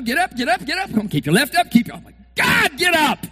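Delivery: high, loud urging; mock fight fan yelling. Preacher yelling at a fight on television. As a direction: yell at the boxer to get up.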